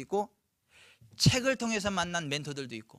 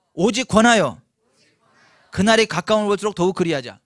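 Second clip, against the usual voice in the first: loud group recitation; 4 semitones higher, 12 dB louder than usual. Shown as a demonstration. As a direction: recite it loudly for the crowd to echo. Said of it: "따라서 하십시다".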